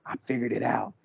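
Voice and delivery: mock secretive reveal; lightly voiced sleuth. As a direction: lean in and whisper the big reveal. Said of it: "and he's like".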